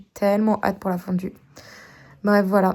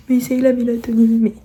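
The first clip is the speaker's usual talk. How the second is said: mocking people; 7 dB louder than usual. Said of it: "les gens qui sont en mode".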